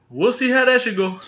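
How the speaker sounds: echoed way up high, teasing; mock high echo. Shown as a demonstration.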